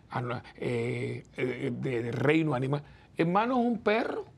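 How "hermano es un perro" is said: higher, exasperated "Hermano"; exasperated punchline, pitched up. Real, not acted. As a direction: say it like it's painfully obvious.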